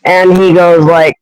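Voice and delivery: usual speaking voice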